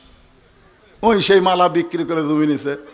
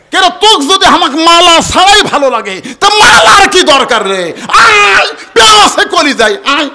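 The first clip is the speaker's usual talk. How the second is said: very high, loud theatrical outburst; hammed-up shouting husband; 12 semitones higher, 10 dB louder than usual. Husband refusing his wife a necklace, exaggerated high shouting. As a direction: cry it out at full pitch, hamming it up.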